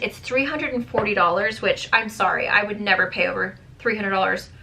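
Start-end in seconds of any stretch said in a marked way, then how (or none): none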